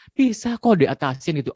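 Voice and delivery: normal talking voice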